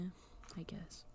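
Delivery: soft grudging whisper; whispered concession. Real, not acted. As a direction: mutter it under your breath. Conceding under breath.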